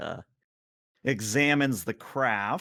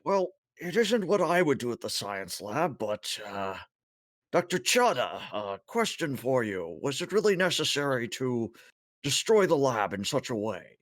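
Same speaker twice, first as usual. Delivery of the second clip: high-pitched, lilting Tholian voice; chittering alien; 4 semitones higher than usual. Acted. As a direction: speak as a fussy, high-voiced alien crewmate. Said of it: "chitters a little bit and says".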